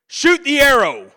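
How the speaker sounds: loud, slow, high command; ordered. A narrator's reading. Prophet Elisha ordering the king to shoot, loud command. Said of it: "Elisha ordered".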